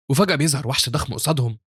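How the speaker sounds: ordinary delivery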